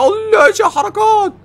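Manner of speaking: high, slow amazed yell; excited shout. Real, not acted. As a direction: cheer it out. Shouts at cool move. (صيحة إعجاب عالية)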